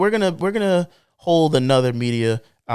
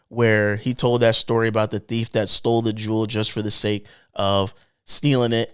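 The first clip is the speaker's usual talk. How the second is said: usual speaking voice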